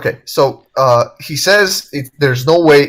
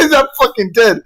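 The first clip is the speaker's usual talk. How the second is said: usual speaking voice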